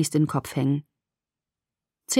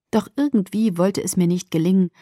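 usual speaking voice

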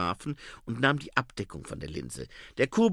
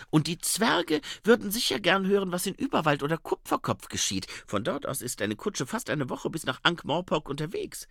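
lightly voiced higher female character; a hint of the eager young reporter; Saccharissa; 6 semitones higher than usual. (leicht erhöhte Frauenstimme)

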